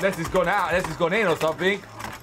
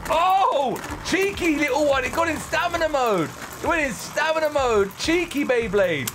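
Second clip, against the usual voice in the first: excited; 7 semitones higher than usual. Real, not acted. Beyblade switched modes.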